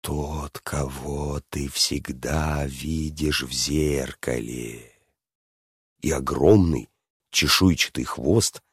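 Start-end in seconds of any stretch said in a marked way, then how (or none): 0.0-4.9 low, soft, very slow dragon voice; creepy slow dragon murmur; sly dragon. A narrator's reading. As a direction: draw it out low and hushed, like a cunning dragon. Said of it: "хитро шепелявым голосом выговорил дракон".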